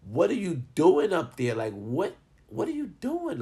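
high, loud, incredulous questioning; baffled outburst. Retold or imitated. Confused onlooker in the back questioning the friend, baffled and high. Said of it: "I'm in the back and I'm confused".

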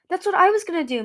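high, loud eager outburst; eager cry. A narrator's reading. Moon eagerly tells Kibble she wanted this too, loud and high. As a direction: blurt it out, thrilled to agree. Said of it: "Moon cried".

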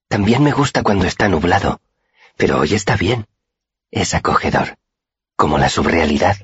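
dreamy young girl, lightly voiced; lightly voiced shy girl Auri. A narrator's reading. Auri wistfully telling her friend of the moon.

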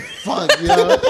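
breathless laughing high curse; high unvoiced laugh. Real, not acted. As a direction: wheeze it out laughing. Laughing at the memory.

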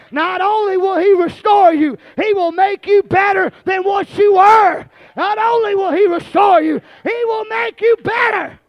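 high, loud, shouted whooping sermon; preaching at full force. Acted. Preacher voicing the father's joy to the church, shouted hard. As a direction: build the congregation up, shouting it out.

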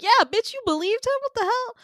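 very high, loud incredulous pile-on; outraged disbelief. Retold or imitated. The whole group turning on Peach, outraged. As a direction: squawk it in acted outrage. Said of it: "and we're all like".

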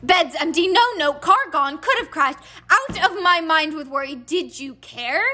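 high, ranting scolding in bursts; raging mother. A narrator's reading. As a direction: let a worried mother's rage break over them. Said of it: "her rage broke over them".

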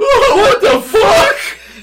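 high drawn-out shriek through laughter; mock shock. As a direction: shriek it in exaggerated horror, laughing. Acting viewer's shock at scare.

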